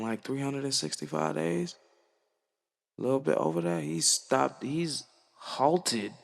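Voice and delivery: normal talking voice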